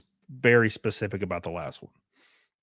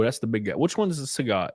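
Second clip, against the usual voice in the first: ordinary delivery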